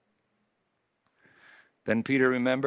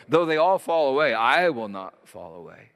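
bold pronouncement; 2 semitones higher, 6 dB louder than usual. Acted. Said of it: "Peter pronounced before everyone".

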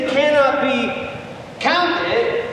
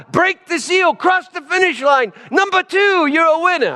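excited; 7 semitones higher than usual. Pretend. Mock race announcer.

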